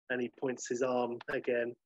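ordinary delivery

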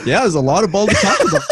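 quoting through a laugh; mock quote, half laughing. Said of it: "basketball podcast host says".

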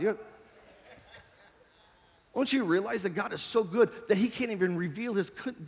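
ordinary talk, nothing marked

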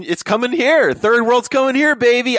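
high, loud and fired up; emphatic. Real, not acted. Warning listeners the third world is coming, high and loud. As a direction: hammer the point home to the audience.

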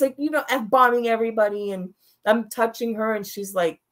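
ordinary talk, nothing marked

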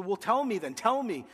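loud, high demand, pleading to be told; retold yell. Pastor yelling at God in prayer, loud and high demand. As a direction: shout it up at God, demanding an answer. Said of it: "I just yelled".

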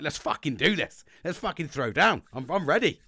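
acting pumped to throw down; egging on a confrontation; up for a fight. Acts out craving a confrontation.

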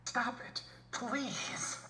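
tiny, airy high-pitched begging; very high plea. Acted. Irrationally angry at an overused phrase, high whispery plea. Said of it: "it's like the [name] song".

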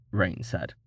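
usual speaking voice

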